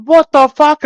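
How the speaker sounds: high, loud shocked yell from his mates; mock yell. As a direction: shout it like the boys can't believe it. Mates riding up, retold shout.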